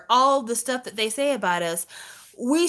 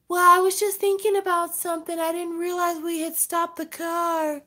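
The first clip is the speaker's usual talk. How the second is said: put-on higher, spacey teen excuse; airy daughter voice; 8 semitones higher than usual. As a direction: play the daydreaming daughter. Voicing daughter's dreamy excuse.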